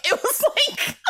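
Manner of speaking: high laughing through the words. Real, not acted. Friend laughing while recapping a horror movie scene. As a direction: break into giggles mid-sentence.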